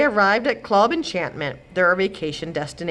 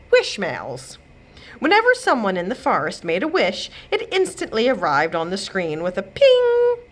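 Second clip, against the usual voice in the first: ordinary delivery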